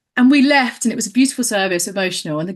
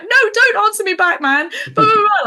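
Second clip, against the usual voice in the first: much higher and louder, hammed-up yelling; mock screaming; 12 semitones higher, 4 dB louder than usual. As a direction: yell at the cat in mock outrage. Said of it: "like, screaming at her".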